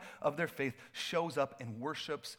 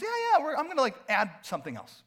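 casual; 8 semitones higher, 7 dB louder, faster than usual. Retold or imitated. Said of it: "he goes".

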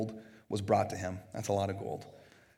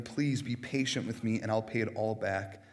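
ordinary talk

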